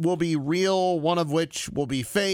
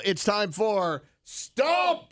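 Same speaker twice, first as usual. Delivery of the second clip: announcer voice; 6 semitones higher, much slower than usual. Acted. Show segment announcement.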